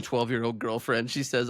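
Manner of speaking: ordinary talk, nothing marked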